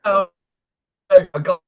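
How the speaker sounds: choppy, breaking-up audio; call dropping out. Real, not acted. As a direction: voice cutting in and out. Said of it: "You're really choppy again".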